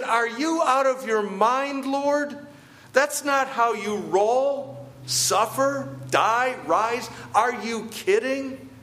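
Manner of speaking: high, outraged Peter scolding Jesus; indignant Peter. Pretend. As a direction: play Peter, indignant and incredulous. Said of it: "That's what's going through Peter's mind".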